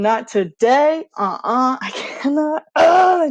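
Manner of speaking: fed up with spiders, rising to a loud groan; panicked refusal. Retold or imitated.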